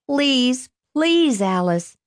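begging. A narrator's reading. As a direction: beg her to give in. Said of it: "Lester begged".

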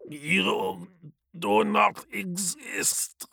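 slow, gurgling through a mouthful of blood. Acted. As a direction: choke the words out wetly. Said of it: "he gurgles through a mouthful of blood".

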